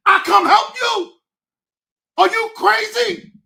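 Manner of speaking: loud, high incredulous yell; angry shout. Real, not acted. Ranting at those who turned away, furious shout. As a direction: yell it at the people who turned their backs.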